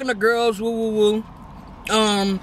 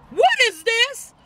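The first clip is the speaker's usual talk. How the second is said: very high, slow mock-appalled mom; mama's outraged voice; 12 semitones higher, slower than usual. Daughter imitating mama confronting her, high and outraged. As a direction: draw each word out high as an imitated mother. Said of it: "that's exactly how she sounded".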